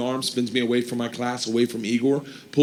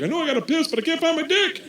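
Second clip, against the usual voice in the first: put-on drunk inner voice; 14 semitones higher, faster than usual. Drunk man's inner thought.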